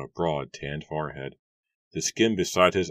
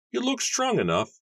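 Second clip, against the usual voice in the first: much higher, put-on voice for the farmer; gruff farmer Matthew. A narrator's reading. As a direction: play the grumpy farmer, pitched way up. Matthew sizes up the young man.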